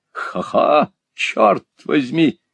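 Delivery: high, breathy laughing fop; sassy drag queen. Acted. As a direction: exclaim with a mincing laugh. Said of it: "воскликнул джентльмен".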